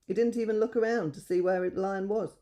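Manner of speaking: ordinary delivery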